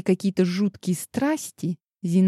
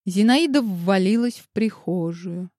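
plain reading voice

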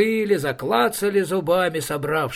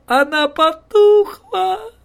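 high, loud, slow wail of grief; crying wail; 9 dB louder, slower than usual. Acted. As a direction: wail it out like a heartbroken child. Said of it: "разом прекращая плакать".

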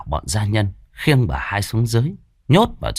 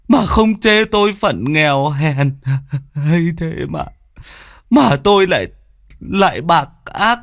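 sobbing apology; 9 semitones higher, 3 dB louder than usual. A narrator's reading. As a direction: cry out your guilt to her. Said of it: "mà nức nở".

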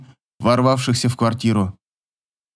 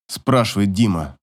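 ordinary delivery, nothing marked

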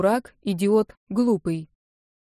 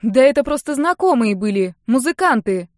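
louder, higher defensive insistence; defensive protest; 4 semitones higher, 7 dB louder than usual. A narrator's reading. Girl defends her guests to her sister, defensive. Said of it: "Защищалась я".

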